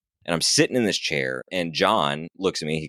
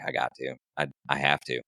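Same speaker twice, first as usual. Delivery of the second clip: softer, lower, worn-out resolve; quiet, defeated; 5 semitones lower, 6 dB quieter than usual. Retold or imitated. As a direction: say it drained, almost to yourself. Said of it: "I'm like".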